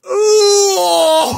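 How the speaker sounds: loud high whoop into laughter; whoop, then crack up; ecstatic whoop. Real, not acted. Pulls a rare promo card.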